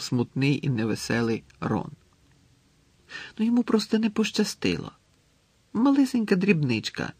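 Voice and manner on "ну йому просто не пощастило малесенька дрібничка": high-pitched, minimising reassurance; light, high consoling voice. A narrator's reading. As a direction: play the failure down for a friend. Consoling a friend after failure.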